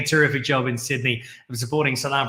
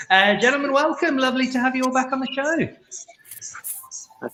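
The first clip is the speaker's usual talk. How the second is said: excited welcome; 11 semitones higher, 4 dB louder than usual. Real, not acted. Host greets returning guests warmly.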